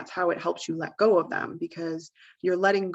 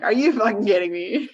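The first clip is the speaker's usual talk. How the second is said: incredulous, half-laughing and louder; laughing disbelief; 3 semitones higher, 7 dB louder than usual. Retold or imitated. Owner finding the dog's mess, laughing in disbelief. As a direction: laugh it off in mock outrage. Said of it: "I might just kinda laugh it off".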